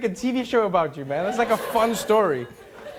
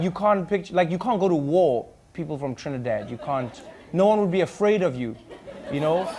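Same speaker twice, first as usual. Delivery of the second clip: ordinary delivery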